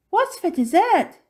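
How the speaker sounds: put-on high, loud child's question; eager child. A narrator's reading. Minx asking about dessert, high and eager.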